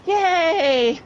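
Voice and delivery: high, drawn-out little yay; mock cheer. Hurt winner hearing his name called, high mock cheer. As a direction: cheer feebly for yourself.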